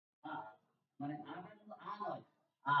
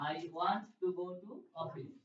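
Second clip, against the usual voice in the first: slow model; 4 dB louder, much slower than usual. Shown as a demonstration. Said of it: "একসাথে বলবো".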